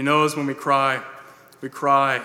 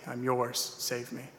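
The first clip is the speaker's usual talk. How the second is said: softer, trailing off into near silence; hushed, fading echo of the prayer; fading whisper-soft plea; 10 dB quieter, slower than usual. Retold or imitated. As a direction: let the repeated prayer die away. Prayer repeated, fading away.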